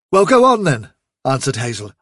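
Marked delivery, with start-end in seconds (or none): 0.1-0.9 raised, louder, challenging retort; impatient retort. Acted. Hazel to Blackivar who wants to leave, impatient retort. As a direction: throw the challenge back at him. Argument over leaving warren.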